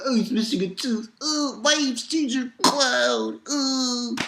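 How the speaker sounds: hammed-up sobbing Caesar; mock crying villain. Villain crying as Luffy comes at him, mock sobbing. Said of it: "He starts crying".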